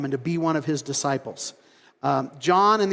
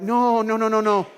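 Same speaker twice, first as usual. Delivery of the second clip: higher, whiny protest against the traitor; protesting disciples; 6 semitones higher than usual. Retold or imitated. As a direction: plead with Jesus, scandalized. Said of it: "they're like".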